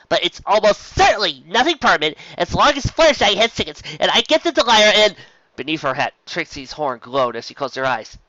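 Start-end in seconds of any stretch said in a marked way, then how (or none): 0.1-5.2 higher, louder hurried explanation; frantic rambling. A narrator's reading. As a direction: pile the words on in a rush. Trixie rushing explanation.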